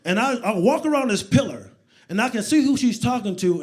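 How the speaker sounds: normal talking voice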